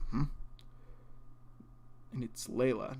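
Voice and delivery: normal talking voice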